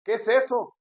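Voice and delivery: high, loud mock protest; imitated indignant pastors. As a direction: protest like pastors scandalized by a small offering. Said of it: "los pastores dicen".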